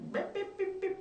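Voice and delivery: high, quick imitation of yapping; mock her chatter as high nonsense noises; high-pitched mock jabber; mimicked yapping. Mimicking woman's chatter on the corner.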